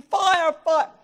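loud high retold shout; shouting fire. Husband retelling how he shouted for help at the fire. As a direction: yell it down the street. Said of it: "I was in the street shouting".

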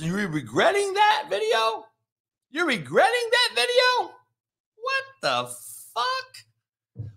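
very high-pitched disbelief; incredulous. Real, not acted. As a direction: voice climbing into a squeak of disbelief. Incredulous at the player's regret.

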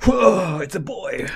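put-on loud high oh, then announce the baby; hammed-up new parent; mock delivery joy.

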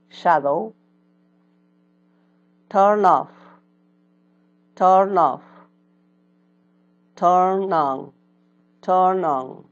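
loud, slow-ish teacher drill, each word twice; vocabulary drill. Shown as a demonstration. Teacher drills English words for students to repeat, loud. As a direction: model each word for the class to echo.

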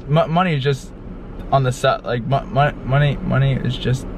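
stumbling over the word, restarting; tongue-tied, halting repeats; stuttering. Real, not acted. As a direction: trip on the first syllable and try again. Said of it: "he'll start stuttering".

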